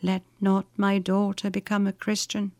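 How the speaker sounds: softly voiced last wish; dying mother's words. A narrator's reading. Dying mother's words rising in her daughter's memory, hushed. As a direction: speak softly, like a remembered deathbed plea. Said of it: "the dying words of her mother".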